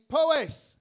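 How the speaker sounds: loud shouted name; calling out. Real, not acted. Comic shouting a county name to the crowd.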